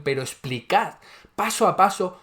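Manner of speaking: usual speaking voice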